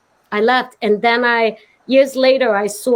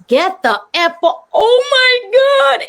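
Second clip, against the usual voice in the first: shocked gasp; 13 semitones higher, 3 dB louder, slower than usual. Retold or imitated. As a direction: curse breaks into a high, slow shocked cry. Realizes it's Ali mid-curse.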